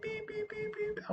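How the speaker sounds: squeaky beep beep; high censor beeps. Retold or imitated. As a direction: beep out the swearing. Censoring swearing.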